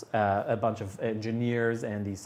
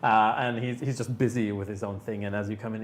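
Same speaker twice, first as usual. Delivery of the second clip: ordinary talk, nothing marked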